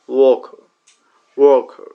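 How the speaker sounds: ওয়ার্কার as "WUH-kuh", long vowel, silent r; correct pronunciation. Shown as a demonstration. Teacher modelling worker, ওয়ার্কার as "WUH-kuh". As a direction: stretch ওয়ার্কার's first vowel, end on schwa. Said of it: "ভুল উচ্চারণ".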